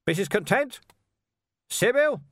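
loud, high calling out; yelling. A narrator's reading. Vimes calling through the hall for Sybil, loud. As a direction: shout through cupped hands. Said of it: "he yelled".

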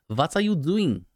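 high-pitched hammed-up German accent; mock German accent. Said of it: "That was my German accent".

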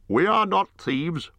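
Bard the bowman. A narrator's reading. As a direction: raise the voice high for a grave herald-like Bard. Bard answers.

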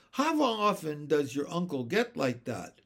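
usual speaking voice

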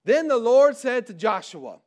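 ordinary delivery

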